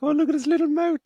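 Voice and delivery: high cutesy coo over a tiny creature; cooing at something cute. Retold or imitated. Cooing over the spider's tiny mouth in a high cutesy voice. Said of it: "you're just like".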